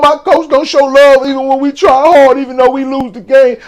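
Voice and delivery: mock-crying athlete, pitched high; Derek Carr. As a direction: imitate a tearful player complaining about the coach. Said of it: "He was on there crying".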